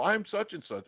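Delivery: high-pitched mock introduction; imitating someone introducing themselves; acted fan introduction. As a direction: pipe up as an eager stranger. Acts out fans introducing themselves.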